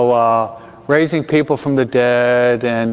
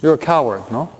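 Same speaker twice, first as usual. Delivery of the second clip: ordinary delivery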